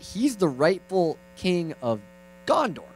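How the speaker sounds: patient uncle explaining; careful teaching. Acted. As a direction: teach it slowly to a small child. Uncle first teaches nephew.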